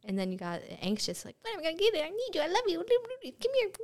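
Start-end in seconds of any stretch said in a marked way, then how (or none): 1.4-3.7 much higher, whiny and needy; desperate pleading. Pretend. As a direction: beg like a desperate lover. Acted anxious money voice.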